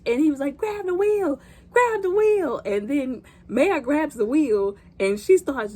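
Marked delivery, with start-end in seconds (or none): 0.6-2.6 very high, strained urgent cry; mock frantic repeated command; imitated panicked order. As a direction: squeal it as the dad in danger. Dad in danger giving orders.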